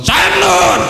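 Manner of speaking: high, loud call of the name; angel's shout. Acted. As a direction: bellow his name like an angry angel. Angel calls out to Samnun.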